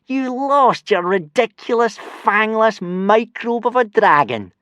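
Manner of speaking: high, slow, sneering bully voice; jeering. Acted. Snotlout mocking Hiccup in a slow, high jeer. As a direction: drag it out to humiliate him. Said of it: "jeered Snotlout".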